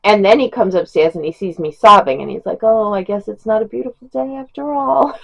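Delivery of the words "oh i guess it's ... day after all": higher, lightly mocking sing-song; teasing husband. Husband finding wife sobbing over a book, teasing. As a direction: imitate him gently ribbing, pitched up.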